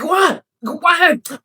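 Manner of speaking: high, loud exasperated what; incredulous what. Retold or imitated. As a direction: throw a baffled what at him. Mocking annoyed questioning of actor.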